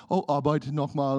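ordinary talk, nothing marked

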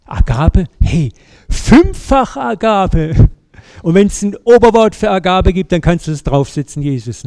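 put-on boastful old Peter. Preacher plays the boastful old Peter bragging to Jesus, loud and brash. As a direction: show off like the proud old Peter, big and loud. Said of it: "Der alte Petrus, was hätte der wohl gesagt".

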